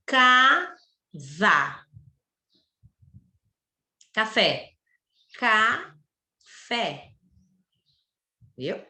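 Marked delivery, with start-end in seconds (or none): 0.1-1.8 CASA drawn out as caa, za; slow, by syllables. Shown as a demonstration. Teacher asking class to fala devagar, CASA said slowly in two beats. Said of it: "Fala devagar". 5.4-7.1 CAFÉ stretched as caa, fé; slow, by syllables. Shown as a demonstration. Teacher showing duas sílabas, CAFÉ said slowly in two beats. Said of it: "Fala devagar".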